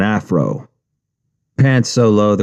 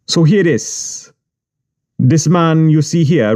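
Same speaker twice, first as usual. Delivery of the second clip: high Jamaican boss voice; boastful Jamaican patois; Jamaican accent; 5 semitones higher than usual. Retold or imitated.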